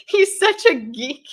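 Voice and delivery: loud, high words broken by laughter; cracking up mid-sentence; real laughing. Real, not acted. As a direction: barely get it out for laughing. Laughing at the friend's dance, texts.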